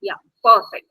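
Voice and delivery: usual speaking voice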